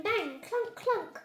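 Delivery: usual speaking voice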